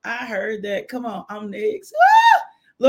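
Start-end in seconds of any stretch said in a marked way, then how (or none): 1.9-2.9 high shouted woo; praise whoop. Real, not acted. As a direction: let out a joyful whoop.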